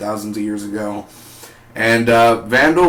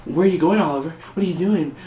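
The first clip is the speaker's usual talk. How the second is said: mock snooping questions; 6 semitones higher, faster than usual. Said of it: "stop snooping around everywhere".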